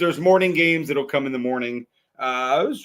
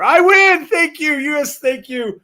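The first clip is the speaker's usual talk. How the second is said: excited; 9 semitones higher, 7 dB louder, slower than usual. Real, not acted. Celebrating a winning pick.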